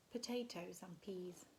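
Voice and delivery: ordinary delivery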